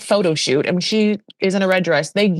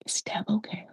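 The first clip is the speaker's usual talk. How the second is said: very soft acted aside; small, quiet voice; hushed question; 3 semitones higher, 12 dB quieter than usual. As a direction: ask it softly, under the breath. Acted hushed question about Deb.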